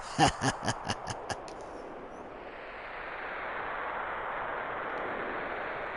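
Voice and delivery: long voiceless villain snicker; creepy breathy chuckle. Acted. Hojo snickering at the parents, long and breathy. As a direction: let a sly hissing laugh trail off. Hojo's laugh.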